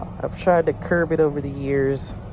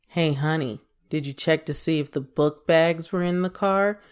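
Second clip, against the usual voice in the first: pointed tone of voice. Shown as a demonstration. Asking honey about the book bags in the car, pointed level tone. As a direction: ask it level, without any friendly lift. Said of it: "That's tone of voice".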